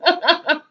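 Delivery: a high, light giggle; short high laugh. Real, not acted. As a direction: giggle at the name. Laughs at chat name.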